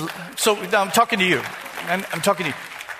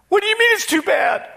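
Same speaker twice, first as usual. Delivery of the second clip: higher-pitched imitation of the church lady; imitating the lady; 13 semitones higher, 4 dB louder than usual. Pastor retelling a counseling visit, voicing the lady higher. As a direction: play her voice higher, plaintive and helpless.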